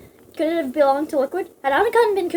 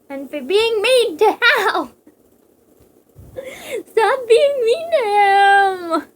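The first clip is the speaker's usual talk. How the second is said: louder, high, slow crying shout; tearful yell; 9 semitones higher, 7 dB louder, much slower than usual. Pretend. The reader defending Hal from Wolf, crying shout. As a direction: wail it in mock outrage.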